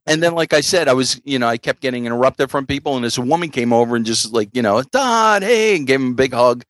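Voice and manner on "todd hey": high-pitched imitation of an excited hello; gushing greeting. Woman running over to hug a comedian, imitated gushing greeting. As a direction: greet a favorite comic, mock delighted.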